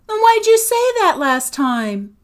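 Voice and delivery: very high, loud protest; indignant challenge. A narrator's reading. Agatha challenges Sophie through the closed door, indignant. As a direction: throw the question back indignantly.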